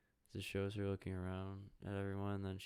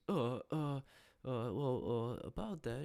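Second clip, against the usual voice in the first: sheepish stammer; 4 semitones higher, 5 dB louder than usual. Retold or imitated. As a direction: caught out, stammering higher.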